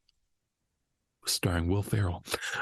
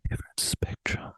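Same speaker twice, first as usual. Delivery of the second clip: dramatic whisper. Acted. Punchline of the podcast name.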